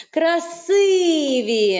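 КРАСИВІ, middle И stretched and emphasized; stress on И. Shown as a demonstration. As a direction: lean long on the И of КРАСИВІ. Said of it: "куди буде падати наголос". (КРАСИВІ з довгим наголошеним И)

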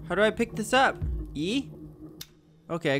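usual speaking voice

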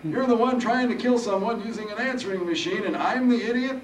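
put-on high, loud, amused old-timer; grinning old Harold. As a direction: throw it back with a grin. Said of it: "Harold grinned".

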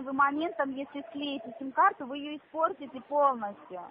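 usual speaking voice

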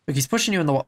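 ordinary delivery

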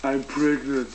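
ordinary talk

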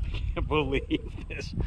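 usual speaking voice

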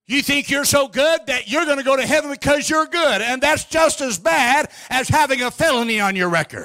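high, forceful revival sermon delivery; fervent preaching. Real, not acted. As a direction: drive it home to the congregation, drawing out key words.

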